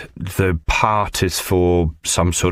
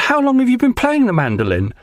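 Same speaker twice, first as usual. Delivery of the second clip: much higher put-on voice for the impressed casting director; imitating casting director; 4 dB louder than usual. Actor retells the casting director praising his mandolin tune in a much higher gushing voice.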